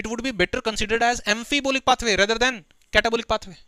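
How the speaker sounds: slow, deliberate dictation pace; slow textbook recitation; reading the textbook aloud. Acted. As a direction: dictate each word to the class. Reads textbook line aloud.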